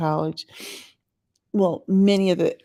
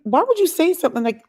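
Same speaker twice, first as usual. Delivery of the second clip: indignant high protest; 10 semitones higher, 5 dB louder than usual. Retold or imitated. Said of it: "And I'm like".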